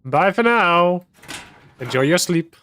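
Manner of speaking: sing-song farewell. Acted. Farewell to in-game character.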